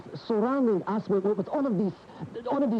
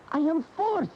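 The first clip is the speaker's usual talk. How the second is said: much higher, forceful cry of compulsion; anguished outburst; 13 semitones higher than usual. Acted. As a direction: burst out, driven and desperate. Bullfighter's outburst in argument.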